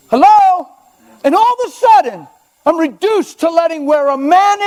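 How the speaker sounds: high, loud wake-up call; loud exclaiming preacher. Real, not acted. Preacher calling out to the congregation, loud and high. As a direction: jolt the crowd awake.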